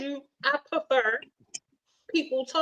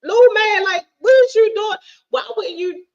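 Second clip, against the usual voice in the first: raised, loud and high, talking down to him; mock scolding yell; 10 semitones higher, 7 dB louder than usual. Telling off her short date in a high loud voice. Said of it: "And I was like".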